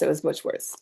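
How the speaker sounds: usual speaking voice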